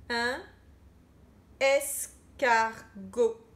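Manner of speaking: very slow syllable-by-syllable dictation; stretched dictation word. Shown as a demonstration. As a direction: drag each syllable out for pupils.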